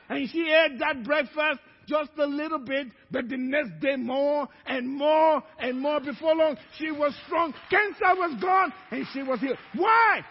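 loud, high, building testimony; full-force preaching. Real, not acted. Preacher proclaiming the cancer was gone, loud and high. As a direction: drive it up to a shouted question.

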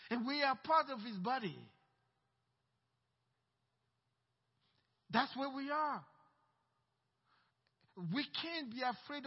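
high, loud, emphatic sermon delivery; forceful preaching. Real, not acted. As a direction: preach it with full conviction.

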